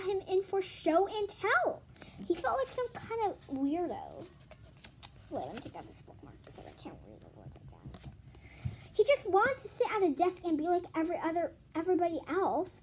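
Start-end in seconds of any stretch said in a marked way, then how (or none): 5.3-8.1 breathy whispered aside to herself. Real, not acted. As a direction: drop to a whisper off the page. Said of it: "unvoiced far whisper aside".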